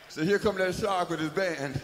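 normal talking voice

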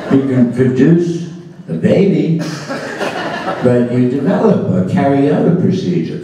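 high, laughing mock objection; laughing disbelief. Speaker mocking the curriculum jargon, laughing high.

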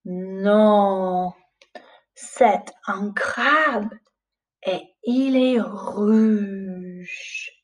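put-on slow, lilting read-aloud for little children; storybook reading. Teacher reads a French picture book aloud to the class, slow and lilting. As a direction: read it like bedtime to young pupils.

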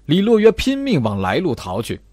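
ordinary delivery, nothing marked

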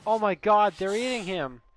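panicked. Acted. Scared kid in a game sees monsters eating someone, panicking. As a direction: panic builds until the last cry stretches out.

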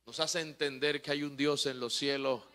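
ordinary delivery

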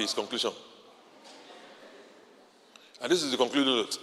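ordinary talk

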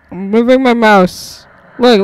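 ordinary talk, nothing marked

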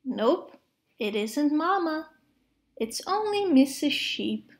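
usual speaking voice

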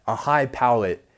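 ordinary talk

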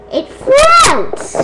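very high shouted squeal; excited squeal. Real, not acted. Seeing the balloon still floats, squealed in excitement. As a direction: shriek "floats" high and stretched.

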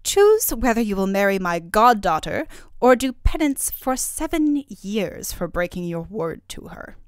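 loud, put-on fairy voice laying down an ultimatum; furious. A narrator's reading. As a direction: threaten him with stern anger. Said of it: "the fairy Mozilla said furiously".

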